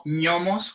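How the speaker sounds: "ñomos" starting with ñ as in ñoqui; ñ pronunciation. Shown as a demonstration. Host proposing a compromise to his friend, ñ sound. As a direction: open "ñomos" on a palatal ny sound. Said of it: "como cuando se dice ñoqui".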